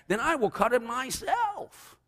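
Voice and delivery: very high, drawn-out declaration; slowly, huffily announce it as the hen; high singsong hen voice; indignant storybook hen. A narrator's reading. Hen declares she will do it.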